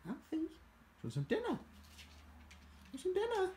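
very high, stretched sing-song to the dog; excited dog talk. Real, not acted. Owner calling his dog to dinner in a high sing-song. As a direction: lure the dog with a bright squeal, slowed on the second.

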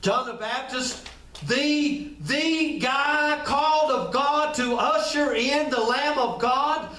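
ordinary delivery

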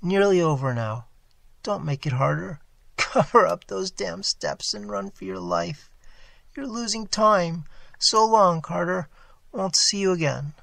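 high, strained farewell; a dying friend's last urging; faint failing voice. A narrator's reading. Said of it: "the faint voice of Warren".

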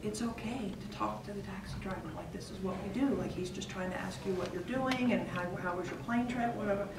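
quietly reassuring son in taxi; hushed aside. Retold or imitated. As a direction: murmur close to his ear. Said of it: "I lean over to him".

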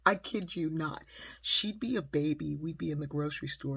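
ordinary delivery

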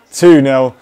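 ordinary talk

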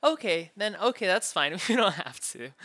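loud, exasperated concession; exasperated giving in. Acted. Captor conceding after anime is refused, loud and exasperated. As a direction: give in loudly and impatiently.

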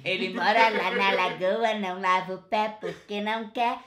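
helium voice. Real, not acted. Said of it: "que que é isso? Gás hélio".

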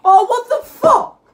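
loud, high, drawn-out disbelief; outraged shout. Real, not acted. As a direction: yell it slowly in real exasperation. Outraged at game mishap.

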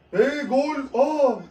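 sudden high excited yell; screaming football commentator. Real, not acted. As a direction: shout at the near goal. Watching live match, goal chance.

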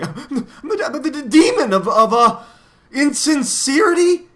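high, loud, stammering acted sobs; sobbing. A narrator's reading. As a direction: wail it haltingly through put-on sobs. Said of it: "he sobbed".

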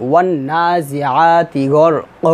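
slow, measured Qur'an recitation ending on a long "ক"; drawn-out vowels, "গড় ক" held open at the stop; recitation with waqf. Shown as a demonstration. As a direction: recite slowly, stretching the final "ক" without n. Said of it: "এভাবে আমাদেরকে".

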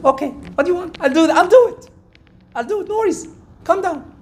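high, hurried-to-agree placating voice; placating husband. Retold or imitated. Replays placating his yelling wife.

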